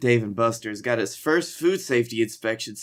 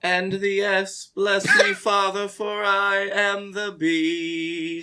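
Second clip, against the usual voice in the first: high, slow showman intro; ringmaster; 6 semitones higher, slower than usual. Pretend. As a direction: draw it out like a circus host. Continues hype intro.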